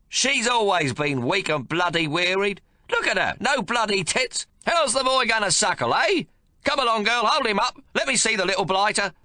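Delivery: put-on high, loud, bossy old grandfather; gruff domineering old man. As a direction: bark orders at the new mother. Old man voiced in story.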